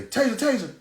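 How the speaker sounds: high-pitched urgent call; mock high officer's cry; imitated police warning cry. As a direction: call out the alarm like a cop. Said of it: "I heard a motherfucker say".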